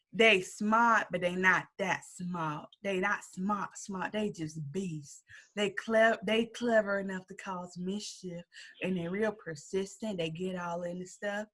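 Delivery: soft, slow, mock menacing drawl; imitating the landlady. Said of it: "Wait, can I do it?".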